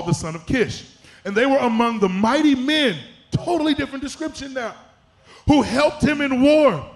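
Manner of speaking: forceful, emphatic preacher's delivery; preaching. Real, not acted. Preacher urging a church not to discriminate, preaching. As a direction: drive each phrase home like a preacher.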